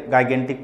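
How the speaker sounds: "गाइगेंटिक" with a hard g at the start; mispronunciation. Shown as a demonstration. Teacher showing students a common misreading, mispronounced with hard first g. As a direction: say "गाइगेंटिक", opening on "guy" instead of "jai". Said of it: "गलत प्रोनाउंशिएट करते हैं".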